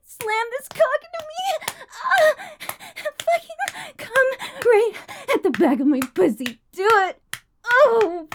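performed moaning with gasps and cries; breathy moaning. Acted. Streamer performing for her chat, moaning throughout. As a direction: moan through every line, pleasure building.

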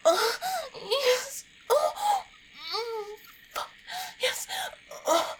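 very high, loud cries of pleasure; loud high-pitched ecstatic outburst; climactic cries. Acted. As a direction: cry out sharply at the peak. Climax of intimate performance.

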